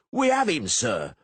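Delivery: high, loud shout. A narrator's reading. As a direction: shout it proudly. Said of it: "the masons shouted".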